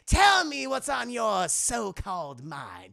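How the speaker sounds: slow, loud villain drawl; Joker. Acted. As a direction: stretch each word mockingly. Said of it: "And he's like".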